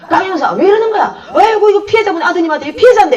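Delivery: loud, high 이러는 with a falling end; flustered colleague. Retold or imitated. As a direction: scold the partner while apologizing. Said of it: "옆에 다른 형사가".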